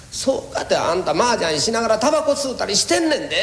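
a hint of a fretting housewife; wife's complaint.

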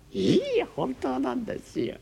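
teasing hostess. Acted.

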